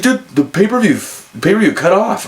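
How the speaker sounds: high-pitched, alarmed friend on the phone; playing the panicked buddy at the bar; retold alarm. Friend reporting the feed cut.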